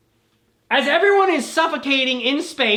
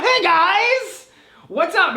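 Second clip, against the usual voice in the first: much higher, louder, slow hey guys; cheery greeting; 10 semitones higher, 6 dB louder, slower than usual. Acted. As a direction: burst in like a goofy hero. Bison bursting in cheerfully.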